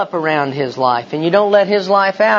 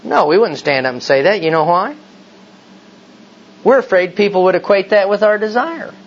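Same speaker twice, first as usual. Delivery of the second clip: normal talking voice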